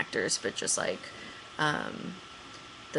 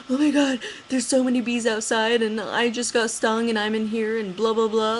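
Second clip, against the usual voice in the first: high, louder upset wail; bawling; 5 semitones higher, 3 dB louder than usual. Retold or imitated. Calling Ryan in tears about the bees, high upset wail. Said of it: "I'm, like, bawling my eyes out".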